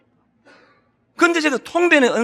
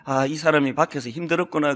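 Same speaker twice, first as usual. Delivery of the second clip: softer, lower and understanding; 7 semitones lower, 5 dB quieter than usual. Retold or imitated. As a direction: think it quietly, with sympathy. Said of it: "이렇게 받아주면". (아내가 지친 남편을 이해하며 조용히)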